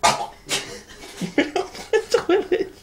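squeaky high laugh words. Real, not acted.

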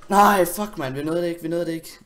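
loud, high cry, then rushing; panicked outcry. Real, not acted. Player too late to save himself, panicked outcry. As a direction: cry out and rush on in dismay.

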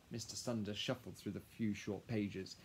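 ordinary delivery

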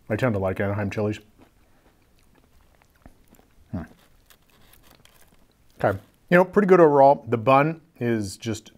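chewing while talking; muffled by food; mouth full of food. Real, not acted. Seen 4: Bites burger at -2s, still chewing while talking downward.

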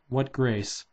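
plain reading voice

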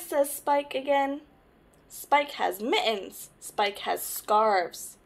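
lively picture-book read-aloud; reading to children. Acted. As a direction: tell it to little ones, brightly.

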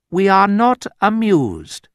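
high, slow, regal disapproval; mock royal put-down; imitating the Queen. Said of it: "when somebody made the English Queen angry, she said".

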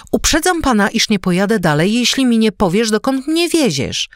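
high, loud warning threat; threatening milady. A narrator's reading. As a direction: demand an answer, raising the voice high. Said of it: "Groźba ta". (groźna, głośna, wysoka pogróżka)

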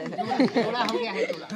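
showman proclaim. Real, not acted. Magician proclaims the pair.

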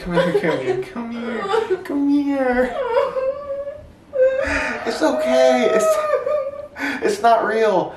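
laughing reveal. Real, not acted. Boyfriend laughing as he reveals the prank to his girlfriend. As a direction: laugh and plead, it was a joke.

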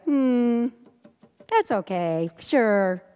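drawn-out, noncommittal brush-off; dubious humouring. Acted. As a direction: humour someone you think is crazy. Voicing people who think you're crazy.

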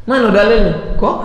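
high acted demand; imitated incredulous challenge; challenging questioner.

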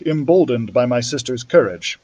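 usual speaking voice

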